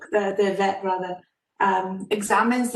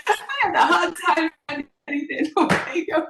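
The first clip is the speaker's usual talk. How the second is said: real laughter after the joke; giggling at her own punchline; laughing; 9 semitones higher, faster than usual. Real, not acted. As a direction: laugh through the words, high and bright with relief.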